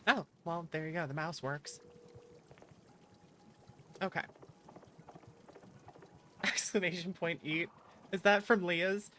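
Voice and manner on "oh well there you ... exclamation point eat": ordinary delivery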